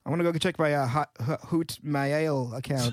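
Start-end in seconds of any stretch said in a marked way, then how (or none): 1.5-2.5 "Hoot Mail" slowly, first word rhyming with boot; "Hoot Mail" with oo for o, mail drawn out; deliberate mispronunciation. Shown as a demonstration. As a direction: say "Hoot Mail" as HOOT may-ail. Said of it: "how do I mispronounce this".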